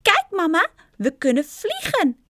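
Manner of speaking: put-on high, loud, gleeful little boy; excited child. A narrator's reading. Winged boy to his mother on flying, excited. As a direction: call out proudly in a high child's voice.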